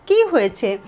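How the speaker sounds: normal talking voice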